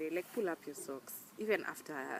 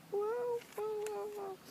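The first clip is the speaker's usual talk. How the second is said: mock amazement; slower than usual. Real, not acted. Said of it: "mock amazement".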